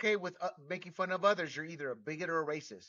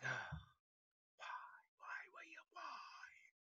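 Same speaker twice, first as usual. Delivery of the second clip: whispered, anguished self-reproach; mock lament; 11 semitones higher, 17 dB quieter, slower than usual. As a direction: whimper it almost without voice, hammed-up. Mimics own lament at times.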